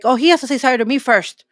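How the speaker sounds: loud, indignant mock of a proud person refusing to apologize; mocking prideful whine. Preacher mocks a proud person refusing to apologize, loud and indignant. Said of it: "Don't be like".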